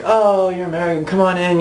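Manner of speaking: high, gushing hello to a newcomer; mock the eager American welcome; lightly voiced friendly American greeter; overly welcoming American. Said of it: "very welcoming".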